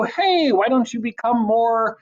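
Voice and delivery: mock suggestion. Voices hypothetical person making a suggestion.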